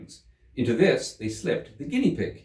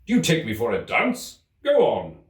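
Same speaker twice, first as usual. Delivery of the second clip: louder, impatient; irritated King; 4 semitones higher, 6 dB louder than usual. Acted. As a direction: snap at the fool to go on. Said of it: "said the King sharply".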